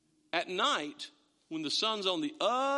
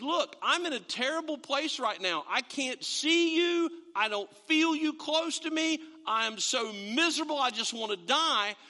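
mock lament; 7 semitones higher, 4 dB louder than usual. Voicing David's despair to God.

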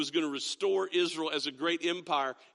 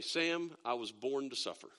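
mock dramatic; 6 semitones lower, 7 dB quieter than usual. Dad answering his daughter's money request, mock tragic. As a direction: lower and softer, a playfully martyred sigh of a line. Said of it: "you're so dramatic".

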